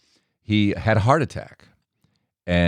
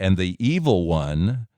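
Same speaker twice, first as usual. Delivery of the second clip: normal talking voice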